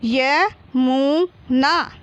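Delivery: very slow YA, MU, NA with gaps; syllable by syllable. Shown as a demonstration. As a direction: sound out Yamuna in three long, separate syllables. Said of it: "तो मिलके क्या हो गया?".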